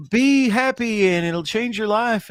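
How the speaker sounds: high, drawn-out mock motivational line; positive pep talker. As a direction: chirp it like a relentless optimist. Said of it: "he's like, this positive Peter person".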